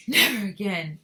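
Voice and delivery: loud gasped vow, lightly acted. A narrator's reading. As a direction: gasp it out after a rough ride. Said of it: "gasped Missus Teavee".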